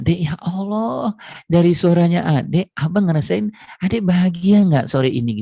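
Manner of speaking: woo your wife with the first words; husband asking first how his wife feels; tender husband. Retold or imitated. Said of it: "Cek feeling dulu".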